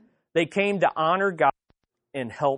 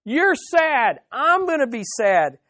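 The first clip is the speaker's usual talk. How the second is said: higher, louder earnest pledge; voicing Job's friends; 6 semitones higher, 5 dB louder than usual. Friends tell suffering Job they will share it, higher and louder. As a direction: speak as the friend vowing to share his grief. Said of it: "They're saying".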